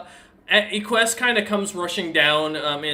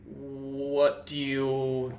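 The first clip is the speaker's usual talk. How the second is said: very slow, quieter in-character question; dim surrendered captive; 4 semitones lower, 5 dB quieter, much slower than usual. Acted. Captive dragonborn answers the one who took him aside, slow and dim-witted. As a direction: drag each word out slowly, rising on the last. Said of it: "looks at you and goes".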